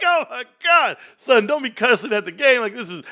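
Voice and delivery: high, laughing mock outburst; hammed-up high-pitched dad reaction; mock exasperation. Dad joking about his reaction to son's excuse, mock exasperation.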